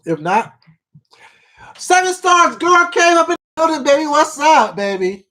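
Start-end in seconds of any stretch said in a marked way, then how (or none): 1.8-5.3 excited greeting. Real, not acted. Excited greeting to a chatter joining.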